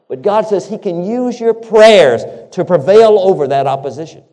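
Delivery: loud, high pulpit proclamation; fervent preaching. Acted. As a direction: proclaim it with full force. Preacher peaks on promise.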